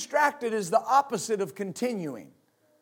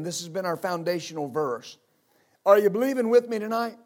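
usual speaking voice

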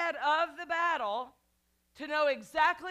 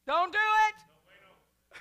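much higher and louder, slow; comic loud warning; 8 semitones higher, 10 dB louder, much slower than usual. Acted. Preacher warning congregation off fear, loud slow comic holler.

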